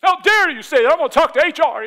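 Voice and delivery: high, loud indignant complaint; mock outraged shriek. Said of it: "our natural response".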